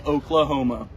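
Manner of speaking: ordinary talk, nothing marked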